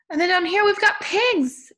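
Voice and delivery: high, loud, delighted announcement; excited reveal. Pretend. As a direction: show little children a thrilling discovery. Excitedly revealing pigs to children.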